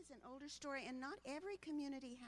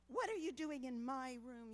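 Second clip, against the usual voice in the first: mild yell; 7 dB louder than usual. Acted. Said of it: "yelled Bart".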